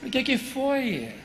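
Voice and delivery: slow, drawn-out asking. Retold or imitated. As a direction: linger on every word, coaxing.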